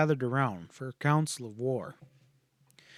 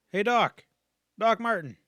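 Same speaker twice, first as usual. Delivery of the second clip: high, raised call across the yard; 7 semitones higher than usual. A narrator's reading. Ranger calling the doctor out of the cabin, raised call.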